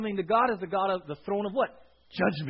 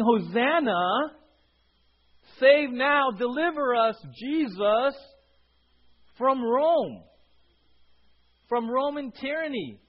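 loud, high, drawn-out preacher's cry; crowd yelling; 6 semitones higher, 6 dB louder, slower than usual. Retold or imitated. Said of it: "Because they were yelling".

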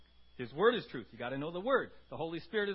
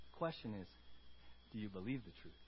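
dropped low and soft; preacher quietly challenging the church; hushed challenge; 8 semitones lower, 9 dB quieter than usual. Real, not acted. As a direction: lean in and ask it quietly.

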